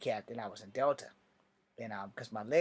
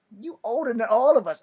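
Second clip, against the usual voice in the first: high, loud teasing remark; mock sergeant voice; amused sergeant; 9 semitones higher, 9 dB louder than usual. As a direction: tease the older recruit.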